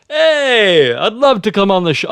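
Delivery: a chirpy American accent, put on. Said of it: "he's got an American accent".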